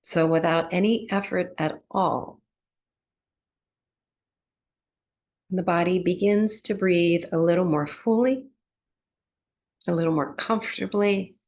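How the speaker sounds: calm, unhurried meditation voice with long pauses; guided relaxation. Real, not acted. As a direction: ease them gently into breath awareness, phrase by phrase. Guides breath awareness and body relaxation.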